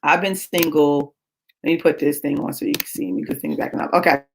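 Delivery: usual speaking voice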